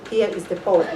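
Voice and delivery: normal talking voice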